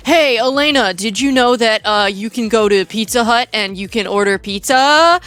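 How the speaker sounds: over-eager staged ad pitch; mock infomercial host; fake sponsor read. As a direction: play an obviously fake sponsorship ad, lifting at the end. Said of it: "like, so fake".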